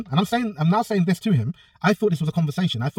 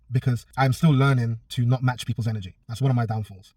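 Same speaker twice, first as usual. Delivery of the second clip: normal talking voice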